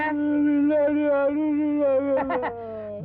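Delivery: long hammed-up manic laugh; put-on lunatic laugh; crazy audition laugh. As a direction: laugh like a madman on cue. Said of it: "என்ன பண்ணுவீங்க".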